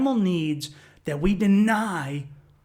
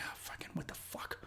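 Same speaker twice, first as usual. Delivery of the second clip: whisper; 22 dB quieter than usual. Retold or imitated. Startled reaction, whispered.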